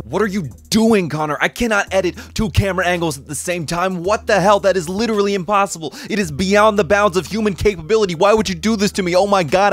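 high, loud screaming meltdown; frantic rage. Acted. Editor screaming at himself over two camera angles, shrill rage. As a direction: scream it at yourself in pure panic.

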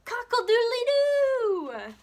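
very high, loud, drawn-out crow; hollered rooster crow. A narrator's reading. Jim crowing into Junie B's ear, hollered slow and loud. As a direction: blast a rooster call right into her ear. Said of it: "he hollered".

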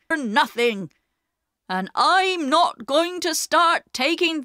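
acted angry outburst from a proud man; indignant shout. A narrator's reading. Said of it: "they heard Perks shout".

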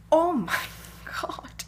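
high, loud "Oh my God" breaking into a whispered laugh; shocked gasp. Retold or imitated. Retelling bumping into Theo, high loud delighted shock. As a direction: gasp it out like you've just spotted a friend. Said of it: "my reaction to Theo was".

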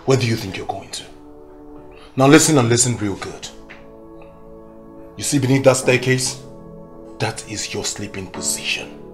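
low, controlled threatening orders; cold menace. Acted. Husband banishing pregnant wife to the staircase, cold menace. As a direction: lay down the law, low and measured.

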